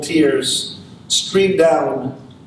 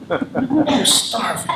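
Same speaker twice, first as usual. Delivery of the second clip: pleading; 4 semitones higher, much slower than usual. Acted. Hungry soul repeats his plea.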